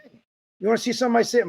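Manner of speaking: lightly imitated Brooklyn salesman, pitched high; high-pitched hint of Brooklyn; Brooklyn accent. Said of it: "my dad, a real Brooklyn accent".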